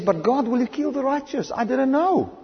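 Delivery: high pleading protest of an innocent king. Retold or imitated. The king protesting to God in a high plea. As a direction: cry out your innocence, voice lifted high. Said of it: "Then he says".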